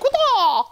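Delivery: high yelp of a lunge; a short jabbing war cry; mock battle cry. GM showing players a spear lunge, mock battle cry.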